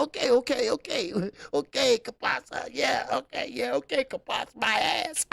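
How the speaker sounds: high, laughing mock voice of the other man; hammed-up sarcastic agreement; mocking impression. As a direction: squeak out his grudging okays. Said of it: "He said".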